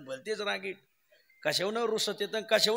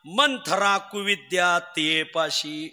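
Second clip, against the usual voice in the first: slow, measured scripture chant, slightly lowered; verse recitation; 3 semitones lower, much slower than usual. Acted. Kirtan preacher quoting the saint's verse to devotees, measured recitation. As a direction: recite each phrase slowly and deliberately.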